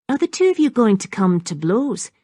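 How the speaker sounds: a lightly lilting, sing-song question; a hint of a lilting taunt; soft, lilting voice. A narrator's reading. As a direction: tease them with a lilt. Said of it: "in her soft, lilting voice".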